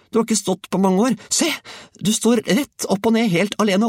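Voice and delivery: put-on high, eager boy's voice; excited calling. A narrator's reading. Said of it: "ropte Georg".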